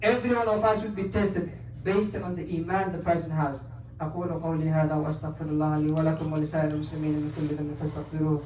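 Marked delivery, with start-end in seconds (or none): 4.0-8.4 low, soft, quick recitation; Arabic khutbah formula. Real, not acted. Preacher closing one part of the khutbah, low formal recitation. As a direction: recite the set praise quietly and evenly.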